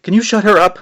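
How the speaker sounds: high, loud yell. A narrator's reading. May yelling at Jack about the witch, loud yell. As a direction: yell it over the noise. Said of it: "May yelled".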